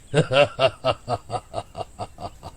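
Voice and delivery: low, drawn-out demonic laugh; mock him with a devil's laugh; evil devil cackle. (शैतानी हंसी)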